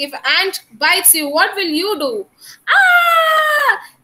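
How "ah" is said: sharp high yelp of pain. Shown as a demonstration. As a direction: yelp like you've just been bitten. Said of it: "Like that you will do".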